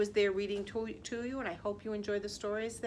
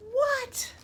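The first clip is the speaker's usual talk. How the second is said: shocked; 14 semitones higher, 5 dB louder than usual. Acted. Reader shocked by book.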